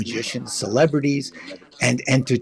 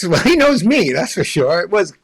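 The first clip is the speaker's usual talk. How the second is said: ordinary talk, nothing marked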